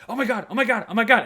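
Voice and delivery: high, loud, giddy dog voice; hammed-up excited squeal of words. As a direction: gush like a smitten little dog. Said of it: "he was like".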